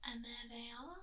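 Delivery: soft whisper pointing at the picture; whispered reveal. Real, not acted. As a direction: hush it like a discovery.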